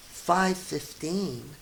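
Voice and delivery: plain reading voice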